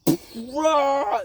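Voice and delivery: short high battle roar; punching roar. Retold or imitated. Luffy throwing his punch, short battle yell. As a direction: let out a sudden high "rah". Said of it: "He just goes".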